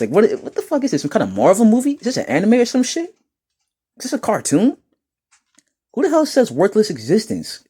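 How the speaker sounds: high, disbelieving mock outrage; incredulous. Real, not acted. Streamer scoffing at a chat troll, high incredulous. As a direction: sound baffled at a silly phrase. Mocking a troll's dramatic phrasing.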